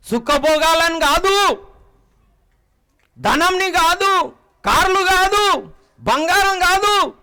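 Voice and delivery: high, loud, slow preaching; preaching at full force. Real, not acted. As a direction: hammer each item out, slow and loud. Preacher rejecting riches.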